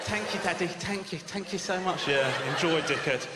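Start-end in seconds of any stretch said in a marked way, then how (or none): 0.0-2.1 put-on higher, softening child voice; mock toddler thanks. Two-year-old thanking his dad for a croissant, lightly voiced child. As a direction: play a grateful little kid.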